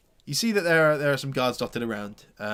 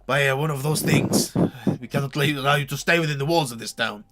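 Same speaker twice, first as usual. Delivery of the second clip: firm guard, a touch louder with a laugh breaking in; stern guard; 4 semitones higher, 7 dB louder than usual. Acted. Guard refuses to let the bitten man stay, mildly stern. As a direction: lay down the law as a town guard. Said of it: "He says".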